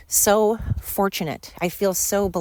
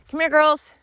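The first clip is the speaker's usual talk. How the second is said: high, louder call; calling the dogs; 9 semitones higher, 4 dB louder than usual. Real, not acted. Dog owner summoning her girls, calling out. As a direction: call them over with raised pitch.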